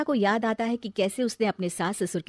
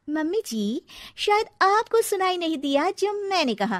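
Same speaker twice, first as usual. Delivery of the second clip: cheeky retort; 10 semitones higher than usual. Acted. Bride answers back cheekily.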